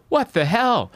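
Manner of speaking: high-pitched mock outrage; indignant outburst. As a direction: act out a startled, offended passenger. Replaying the woman's startled protest.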